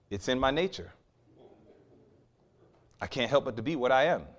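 hushed, matter-of-fact scorpion reply; quiet admission. Acted. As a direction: answer the dying victim softly, without any protest. Scorpion's resigned answer to dying frog.

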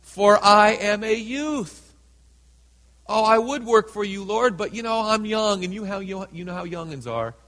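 loud, high, whiny pleading; hammed-up excuse. Jeremiah begging off the Lord's call, loud and whiny. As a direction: overplay the young man begging off.

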